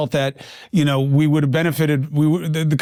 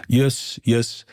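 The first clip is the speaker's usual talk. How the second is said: put-on lower, halting old-man voice with hesitant uhs; Bernie Sanders impression; 6 semitones lower than usual. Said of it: "It's not bad".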